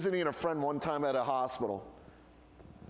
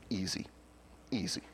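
lower, softer, unhurried calm; calm soothing; 10 semitones lower, 7 dB quieter than usual. Retold or imitated. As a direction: settle him down with easy calm. Calm Navy woman soothing him.

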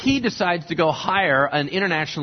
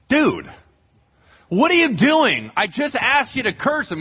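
exasperated complaint; 6 semitones higher than usual. Retold or imitated. King complains to Balaam.

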